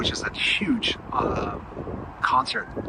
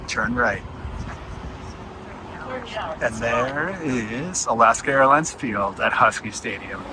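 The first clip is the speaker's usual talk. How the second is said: usual speaking voice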